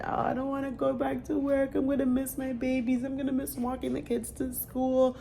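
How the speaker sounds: high, whiny put-on voice; mock whine. A sad mom mocking her own whining about going back to work. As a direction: play up the sad mom moaning.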